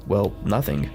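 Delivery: ordinary talk, nothing marked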